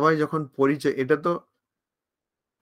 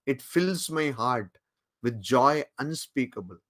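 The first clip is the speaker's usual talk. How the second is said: extremely slow, each word drawn out; slow solemn quote; 4 dB quieter, much slower than usual. Retold or imitated. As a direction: savour the words as a sacred quotation. Quoting Vivekananda again. (অত্যন্ত ধীরে, গম্ভীর উদ্ধৃতি)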